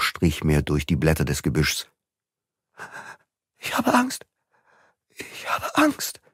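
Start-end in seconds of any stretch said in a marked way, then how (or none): 3.6-4.3 high, trembling with fear; frightened. Acted. Suzanne frightened in the dark. 5.2-6.2 high, slow, breathless whisper; frightened whisper. Acted. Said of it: "wiederholte Suzanne".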